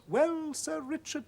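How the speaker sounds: a hint of a fretful old housekeeper, drawn out. Housekeeper voiced in story.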